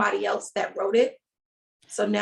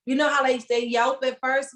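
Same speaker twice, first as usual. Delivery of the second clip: usual speaking voice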